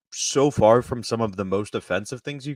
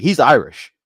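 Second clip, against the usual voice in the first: loud, higher proud declaration; grandpa's proud claim; 6 semitones higher, 10 dB louder than usual. Retold or imitated. Grandpa claiming Shaq as family, loud and proud. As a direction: shout it like a grandpa claiming a star. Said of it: "my grandpa tried to be like".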